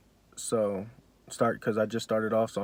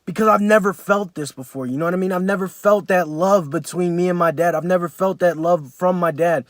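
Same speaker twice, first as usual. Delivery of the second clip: overwhelmed emotion; 7 semitones higher, 8 dB louder than usual. Real, not acted. Retelling first hug, overwhelmed.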